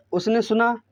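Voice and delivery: ordinary talk, nothing marked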